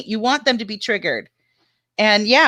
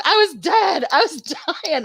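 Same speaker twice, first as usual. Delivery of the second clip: laughing; 7 semitones higher than usual. Real, not acted. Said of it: "I was laughing so hard".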